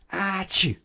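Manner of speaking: a sudden high sneeze cutting the line; sneeze mid-sentence. Acted. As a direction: break off with a big sneeze. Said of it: "he warned".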